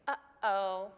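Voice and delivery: "Uh-oh" broken by a catch in the throat; glottal stop. Shown as a demonstration. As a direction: close the throat briefly inside "Uh-oh". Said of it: "closes up just for a second".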